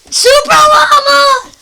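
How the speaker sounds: wailing loud, slow call; crying shout. Acted. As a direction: cry out after the llama. Man cries for the llama.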